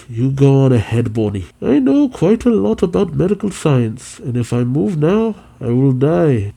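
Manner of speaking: injured scientist, voice rising high; Vegapunk. Acted. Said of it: "The injured Vegapunk says".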